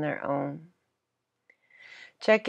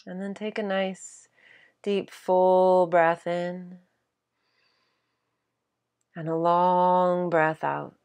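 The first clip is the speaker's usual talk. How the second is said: guided meditation. Acted. Teacher guiding listeners to simply stop and be present, guided meditation. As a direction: guide them gently into stillness, leaving space between phrases.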